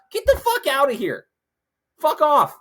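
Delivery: loud, high furious yell; angry outburst. Real, not acted. Ranting about spectators in the road, loud angry yell. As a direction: blow up at the people blocking the road.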